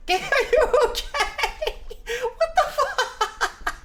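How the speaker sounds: high-pitched laughing disbelief; helpless high giggling voice; laughing hard. Real, not acted. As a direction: squeal the words through real laughter. Cracking up at game glitch.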